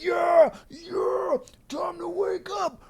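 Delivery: high, strained pump-up cry; mocking gym bro. Host mocking a guy hyping himself, high strained cry. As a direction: whine yourself into the lift like a hyped gym bro. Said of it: "you're just like".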